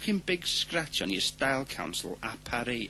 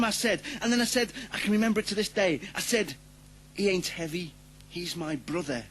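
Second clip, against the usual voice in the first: overwrought melodrama; 7 semitones higher than usual. Acted. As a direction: pour it out like a tearjerker memory. Tearful melodramatic war story.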